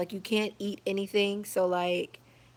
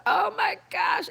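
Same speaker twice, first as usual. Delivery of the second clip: sickened exclaim; 13 semitones higher, 4 dB louder, slower than usual. Retold or imitated. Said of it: "And I'm like".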